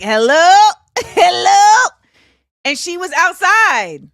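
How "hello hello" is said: loud, rising "hello" into the void; calling out. Retold or imitated. Performer left hanging on stage, calling out for the missing colleague. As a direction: call out louder, pitched up at the end. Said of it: "I was literally on stage like".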